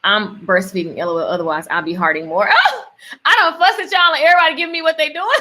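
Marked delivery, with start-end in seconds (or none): 2.5-5.3 high-pitched, quick, laughing chatter. Real, not acted. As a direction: talk fast through a burst of laughter. Bursts out laughing while chatting.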